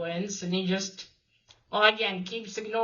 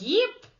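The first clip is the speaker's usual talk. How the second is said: a sudden yip, much higher and louder; startled yip; 12 semitones higher, 6 dB louder than usual. Retold or imitated. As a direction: yelp like a startled little dog. Dex startled by mutated kid.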